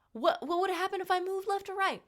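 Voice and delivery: high-pitched, alarmed squeak; mock panic. Patient holding own needle in her arm, high mock panic.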